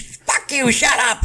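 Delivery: high, squeaky mock retort; mock indignant. Quoting a coworker's embarrassed retort, high mock voice. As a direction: mimic her huffy comeback in a high voice.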